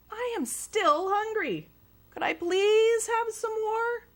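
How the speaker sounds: put-on high, pleading boy voice; hungry little boy; boy asking. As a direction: beg politely like a hungry kid. Child asks again.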